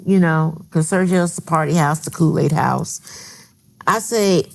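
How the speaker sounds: ordinary delivery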